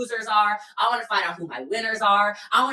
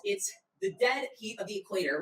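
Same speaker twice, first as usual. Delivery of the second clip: ordinary talk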